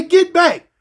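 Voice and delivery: high, loud mock order; imitating an officer yelling; shouted command. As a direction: yell it holding the mace. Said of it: "I say".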